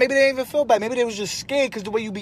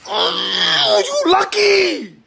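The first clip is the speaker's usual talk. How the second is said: whiny fake crying; 7 semitones higher, slower than usual. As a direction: wail like a sulking kid who lost. Son whines and fake cries.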